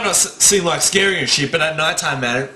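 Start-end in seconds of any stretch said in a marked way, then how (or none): none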